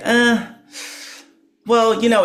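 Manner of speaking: high, hesitant condescending start; a hint of a condescending instructor; mock hesitant instructor. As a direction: hem and haw like a patronizing teacher. Imitating the instructors' reply.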